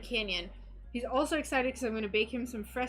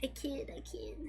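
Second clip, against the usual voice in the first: soft whispered retraction; whispered joke; 13 dB quieter than usual. Real, not acted. After teasing a viewer, a whispered take-back. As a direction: whisper it as a sly aside.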